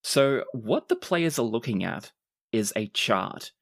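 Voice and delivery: normal talking voice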